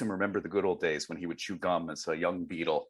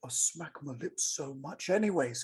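[name] impression; 5 semitones higher than usual. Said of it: "and he's like".

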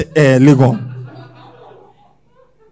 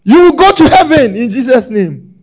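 mock pastor proclamation; 7 semitones higher, 8 dB louder than usual. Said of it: "Have you ever heard any pastor say that".

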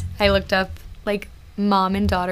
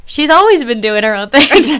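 mock loud answer; 5 semitones higher, 11 dB louder than usual. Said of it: "And you're like".

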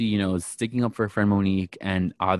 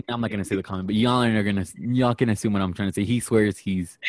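ordinary talk

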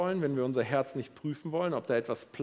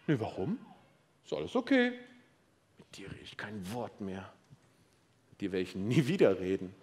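passive-aggressive; 5 semitones lower than usual. Pretend. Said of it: "Oder du wirst passiv-aggressiv".

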